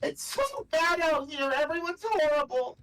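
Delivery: very high, sobbing, broken apology; crying apology. Acted. Phelan sobbing her apology to Briar, high and tearful. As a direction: sob out the apology in a high, cracking voice. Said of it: "wow, I can't do the voice".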